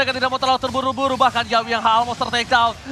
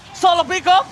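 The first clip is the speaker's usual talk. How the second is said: louder, higher hype call; excited shout; 7 semitones higher, 6 dB louder than usual. Real, not acted. Caster repeats, louder.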